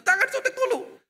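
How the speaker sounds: very high mock-panicked squeal; panicky plea. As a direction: beg the nurse to wait. Father pleads with nurse again.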